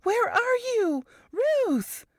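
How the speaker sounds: high, urgent call from afar; Varys calling out. A narrator's reading. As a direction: call her name in a panic. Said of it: "Varys's voice came from a distance".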